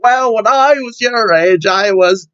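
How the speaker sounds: high, put-on old man, drawing out the end. Old man lecturing the young, high put-on voice. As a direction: reminisce like a hammed-up old man. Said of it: "Just let me use my old man voice".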